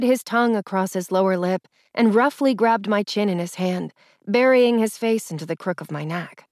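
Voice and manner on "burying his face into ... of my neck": ordinary delivery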